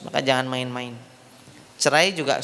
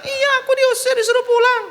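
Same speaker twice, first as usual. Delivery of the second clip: very high hammed-up wail; mock crying; 5 dB louder than usual. Wife insisting to mother she was expelled, mock crying. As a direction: bawl it to mother in a fake crying voice. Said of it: "Dia pulang nangis".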